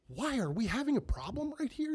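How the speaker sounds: high, menacing question; squaring up to him; threatening challenge. Retold or imitated. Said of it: "And I go".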